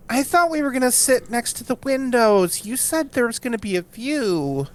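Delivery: nasal, a little annoying complainer. Acted. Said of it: "in a rather nasal voice".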